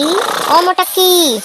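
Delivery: sing-song calling out; drawn-out call. Acted. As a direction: call her name across the house, slowly. Calling to wake sleeper.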